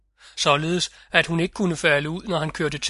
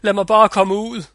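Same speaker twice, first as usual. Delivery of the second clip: louder, higher plea to be let out; råbende; 4 semitones higher, 6 dB louder than usual. A narrator's reading. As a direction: call out from inside a chest. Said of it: "råbte degnen inde i kisten". (råber højere og lysere)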